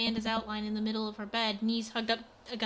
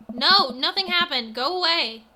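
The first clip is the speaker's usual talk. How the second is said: upset; 5 semitones higher, 9 dB louder than usual. Acted. Amanda snapping defensively.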